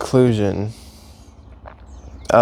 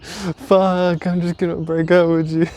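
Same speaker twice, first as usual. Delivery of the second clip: fed-up outburst; 7 semitones higher than usual. Retold or imitated. Frustrated breakup line.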